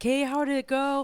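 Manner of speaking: high-pitched eager greeting; super excited. Retold or imitated. Husband waiting in the car after the test, super excited. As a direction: imitate someone bursting to hear the news. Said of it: "He's super excited".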